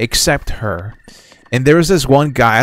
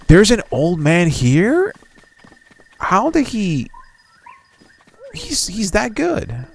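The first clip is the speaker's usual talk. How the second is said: amazed disbelief; 9 semitones higher, slower than usual. Real, not acted. Surprise at old man appearing.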